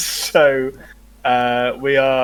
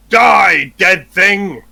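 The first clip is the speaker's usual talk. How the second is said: battle cry; 5 semitones higher, 6 dB louder, much slower than usual. Acted. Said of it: "will say".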